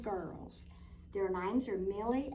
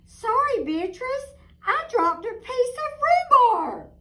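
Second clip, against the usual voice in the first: put-on squeaky little girl, apologetic; high child voice; 14 dB louder than usual. A narrator's reading. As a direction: say it like a small sorry kid. Millie apologizes in story.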